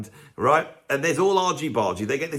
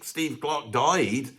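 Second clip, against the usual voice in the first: usual speaking voice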